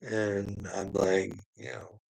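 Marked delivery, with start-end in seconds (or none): none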